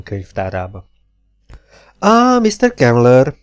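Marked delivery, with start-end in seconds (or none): 2.0-3.3 high, slow delighted exclamation; old man cries out a welcome; cried out. A narrator's reading. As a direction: call out his name in glad surprise. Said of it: "kiáltott fel egy idős férfi".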